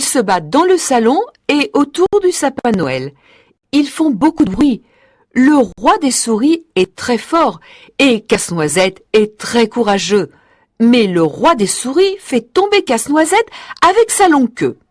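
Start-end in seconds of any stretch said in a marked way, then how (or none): none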